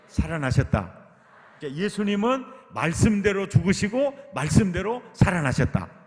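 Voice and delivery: loud, driving pulpit proclamation; full-force preaching. Acted. Preacher proclaiming to the congregation, loud and driving. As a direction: hammer each phrase home to the congregation.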